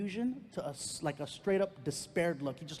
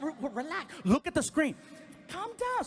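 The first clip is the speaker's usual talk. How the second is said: put-on panicky girlfriend; 12 semitones higher, 3 dB louder than usual.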